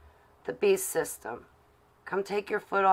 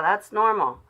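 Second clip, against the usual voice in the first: ordinary delivery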